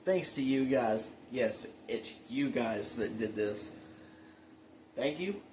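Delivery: usual speaking voice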